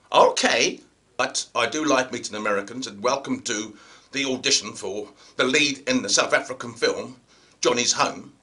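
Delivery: low, measured casting-director voice; low, level audition host; audition caster. Acted. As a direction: drop low and welcome them evenly. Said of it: "it went something like this".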